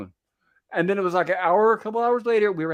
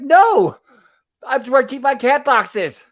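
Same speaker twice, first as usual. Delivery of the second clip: mock shout; 6 semitones higher, 7 dB louder, slower than usual. Quoting Bill's angry refusal.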